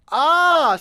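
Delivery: loud, high "aah" outcry; mock scream. Co-host acting out everyone's reaction to the wrestler in undies, mock scream. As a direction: cry out as the whole world's reaction.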